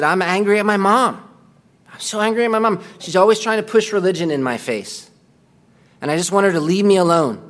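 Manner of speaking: a hint of a sulky young man complaining about his mom; retold angry son. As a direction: voice the resentful grown son, lightly. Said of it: "the young man was angry".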